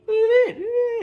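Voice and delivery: shoots way up into a delighted squeal; a sudden giddy high-pitched "look at that"; excited squeal. Real, not acted. Showing viewers the shiny part, excited squeal.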